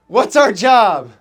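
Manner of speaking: loud, slow rallying call; coach's call-out. Retold or imitated. Coach rallying his players, loud slow call. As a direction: call it out to the whole squad.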